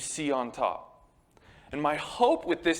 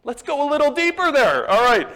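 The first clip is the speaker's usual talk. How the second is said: very loud, high hammed-up urging; exaggerated high, booming encouragement; mock prodding; 11 semitones higher, 10 dB louder than usual. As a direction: call out like an over-eager counselor. Acted counselor prodding.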